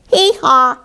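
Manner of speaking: high two-note hee-haw; donkey bray. A narrator's reading. Donkey waking the stable, high bray. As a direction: imitate a donkey's loud bray. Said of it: "brayed Donkey".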